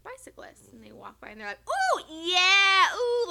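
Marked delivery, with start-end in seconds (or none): 1.7-3.3 loud, high mock catcalling; hammed-up leering whoop; imitated catcall. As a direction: hoot like men catcalling passers-by. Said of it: "like, you know, catcalling us".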